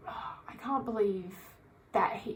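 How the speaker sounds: slow whispered aside, barely voiced; hushed disbelief. Real, not acted. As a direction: breathe it out in disbelief.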